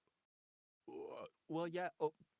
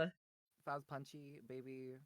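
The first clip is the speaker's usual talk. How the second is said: very soft, lower-pitched voice; 3 semitones lower, 11 dB quieter, slower than usual. Pretend. Guest answers a question about Punchy, soft. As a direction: drop to a hushed, low murmur. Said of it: "Now you're all soft".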